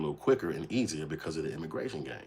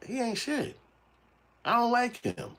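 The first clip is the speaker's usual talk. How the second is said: much higher, sulking; high bitter voice; slower than usual. Retold or imitated. Robbed friend sulking about Eddie, high and bitter. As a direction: complain you were betrayed. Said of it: "you start saying".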